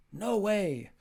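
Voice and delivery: blunt rejection of a rude request; flat refusal. Shown as a demonstration. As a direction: shut it down sharply. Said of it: "reject polite requests".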